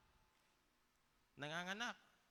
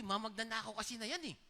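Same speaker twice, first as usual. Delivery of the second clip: fast, louder, higher retold rant; mock angry outburst; 7 semitones higher, 5 dB louder than usual. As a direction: replay your own annoyance at the TV, half-joking. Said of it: "galit na galit ako". (pabirong galit na pagsabog)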